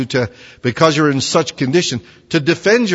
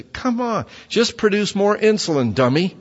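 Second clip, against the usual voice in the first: mocking; 8 semitones higher than usual. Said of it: "just say".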